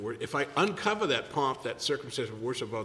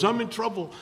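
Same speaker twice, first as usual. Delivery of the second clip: mock panic; 8 semitones higher than usual. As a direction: plead like a man in a crisis. Panicked friend voiced high.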